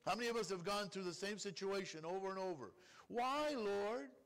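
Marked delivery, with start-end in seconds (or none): 3.1-4.2 higher, louder plea of why; whiny complaint. Retold or imitated. A believer repeating the same trial, mock whining. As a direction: moan the question at God.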